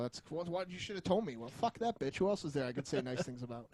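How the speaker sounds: ordinary talk, nothing marked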